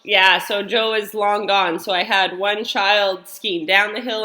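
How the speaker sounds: ordinary delivery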